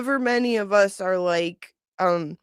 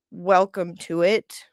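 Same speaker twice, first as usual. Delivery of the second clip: usual speaking voice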